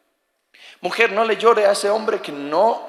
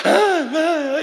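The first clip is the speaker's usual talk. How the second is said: mock sobbing; 10 semitones higher than usual. Imitating crying in sleep.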